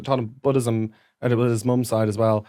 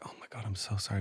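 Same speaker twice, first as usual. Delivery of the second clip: soft, unvoiced, hushed sorry; whispered apology; 3 semitones lower, 18 dB quieter, faster than usual. Teacher consoles the grieving student, whispering. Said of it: "And she was like".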